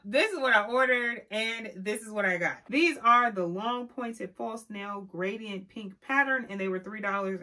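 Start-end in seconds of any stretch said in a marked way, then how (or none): 0.0-1.3 higher and louder, expectant build-up; hyped-up setup. Acted. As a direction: sell the dream of the order. Ordered vs got meme contrast.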